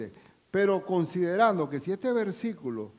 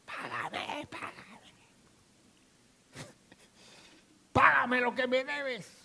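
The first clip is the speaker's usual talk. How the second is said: strangling demand; 8 semitones higher, slower than usual. Acted. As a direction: squeeze the debt out of him. Servant choking his debtor.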